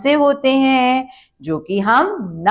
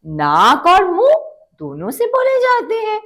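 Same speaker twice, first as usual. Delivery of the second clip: नाक और मुंह said high and rising, then दोनों से बोले जाते हैं climbing much higher and faster; stressed key phrase; 12 semitones higher than usual. Shown as a demonstration. Teacher stressing नाक और मुंह to the class, pitch climbing. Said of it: "अनुनासिक शब्द होते हैं".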